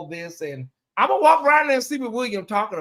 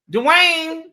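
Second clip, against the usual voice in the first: first try at mama calling out Dwayne, higher and louder; 11 semitones higher, 7 dB louder than usual. Retold or imitated. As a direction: call the name out like his mama. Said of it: "they love for me to do that reciting".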